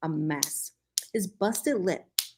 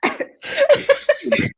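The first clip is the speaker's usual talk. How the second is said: giggling through a whisper, high; laughing whisper; 7 dB louder than usual. Real, not acted. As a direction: crack up while still whispering. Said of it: "We're both whispering".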